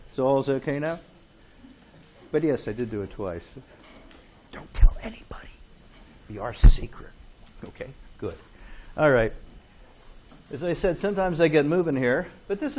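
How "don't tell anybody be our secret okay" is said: hush, playful secret to the class; mock secret whisper. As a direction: whisper it like a conspirator. Teacher jokingly swears class to secrecy.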